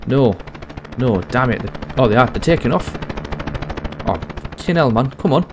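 rising, exasperated yell of defeat; frustrated outburst. Real, not acted. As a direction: shout after the departing chopper. Helicopter leaves without him.

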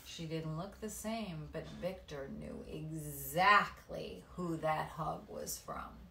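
usual speaking voice

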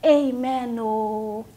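hammed-up drawn-out amen; imitating her mother. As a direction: mimic a mother's eager amen. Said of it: "your mother goes".

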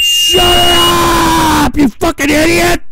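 real enraged yelling at the troll; furious screaming. Real, not acted. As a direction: scream over the blaring clip to kill it.

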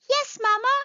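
high-pitched, eager little cry; excited little mole. A narrator's reading. Little mole answers mamma, crying out high and eager. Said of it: "cried Little Mole".

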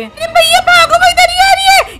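high, loud squeal of alarm; panicked warning. Acted. Companion warns Franklin the ghost is coming, panicked squeal. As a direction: shriek the warning in a small, high voice.

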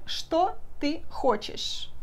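very slow, each Russian word laid out; slow clear demo. Shown as a demonstration. Teacher slowing down to model the Russian question. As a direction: spell the question out slowly for students. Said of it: "Podemos perguntar".

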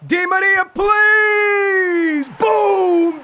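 high, loud, stretched-out yell; urgent goal shout; pleading shout into celebration. Real, not acted. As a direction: beg at full voice, then explode. Pleading for goal, then scoring.

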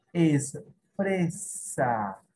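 es fresa drawn out very slowly; slow dictation. Shown as a demonstration. Teacher dictates slowly to her pupils. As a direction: spell it out slowly for the class. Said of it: "La palabrita con pre".

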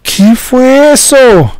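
high, slow shaken question; frightened. Real, not acted. Right after the jump scare.

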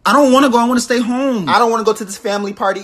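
loud, whiny refusal; sulky teen refusing to go, loud; whining kid. Acted. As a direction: whine like a stubborn child.

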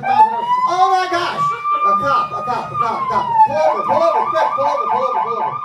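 shrieking very high and loud; mock panic. Hypnotist spotting a cop, shrill loud mock panic. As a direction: squeal in comic terror. Cop appears in imaginary drive.

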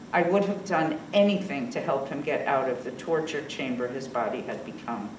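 ordinary delivery

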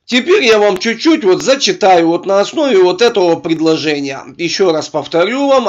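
ordinary talk

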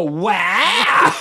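loud, hammed-up awe; mock fake amazement. Bullshit artists humouring the ghost hunter, mock amazement. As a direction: feign being hugely impressed. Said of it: "we're all bullshit artists".

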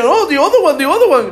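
high, loud mimicked English with a laugh; eager mimicry. Man asking preacher for another bill, eager mimicry. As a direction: imitate an excited plea in English.

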